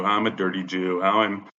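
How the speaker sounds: ordinary talk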